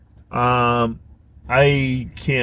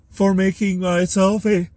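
yawning; 7 semitones higher than usual. Real, not acted. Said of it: "also yawning".

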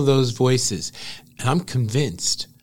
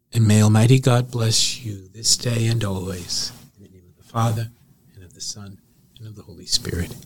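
cathedral echo; 3 dB louder than usual. Acted. Bishop leads closing blessing.

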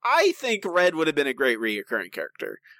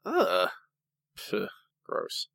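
mock disgust; 4 semitones lower, 6 dB quieter than usual. Real, not acted. Disgust at turkey burger.

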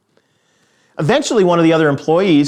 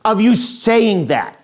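very slow, high-pitched mock outburst; drawn-out fed-up scolding; exasperated father; 8 semitones higher, much slower than usual. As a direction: imitate a dad losing his mind, stretching each word. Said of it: "he would just say in a very loud voice".

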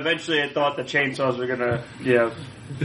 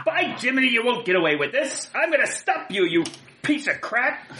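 hammed-up heroic threat, pitch jumping high; hero's defiant retort; 5 semitones higher than usual. Player defies the industrialist, mock-heroic threat. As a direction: defy the villain like a corny hero. Player's hero answers villain in role.

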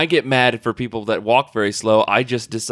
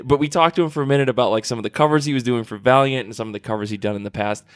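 ordinary delivery

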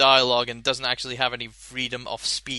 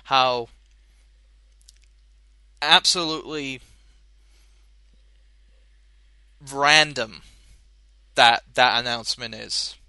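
dismayed; 4 dB louder than usual. Real, not acted. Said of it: "the dismay in my kind of, like, tone".

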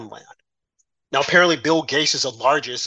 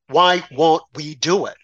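normal talking voice

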